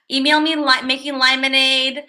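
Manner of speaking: normal talking voice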